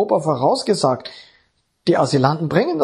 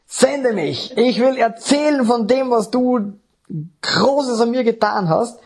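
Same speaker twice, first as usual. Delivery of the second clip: higher, eager offer to God; eager prayer; 6 semitones higher than usual. Retold or imitated. New convert eagerly tells the Lord to send him, raised. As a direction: pledge yourself with raised, eager zeal. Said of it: "habe ich zum Herrn gesagt".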